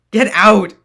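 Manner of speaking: high furious yell; angry shout. Acted. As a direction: drive her away shouting. Sentinel yelling at Matrona.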